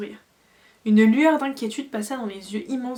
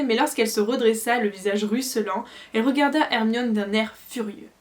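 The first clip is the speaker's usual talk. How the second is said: usual speaking voice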